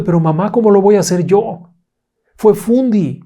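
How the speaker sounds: put-on high, loud child voice insisting; a small girl pleading innocence; little girl protesting. A narrator's reading. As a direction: daughter protesting her innocence to her angry mother, high child voice. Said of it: "Y ella responde".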